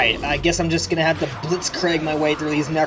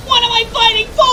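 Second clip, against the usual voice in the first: high, loud anguished hero cry; dramatic hero shout; 8 dB louder than usual. Shown as a demonstration. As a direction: belt it out like an anime hero. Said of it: "it's more like this".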